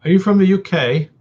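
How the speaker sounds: high, playful British accent; put-on British accent. Shown as a demonstration. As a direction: ask it in a mock British voice. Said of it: "said that with an accent".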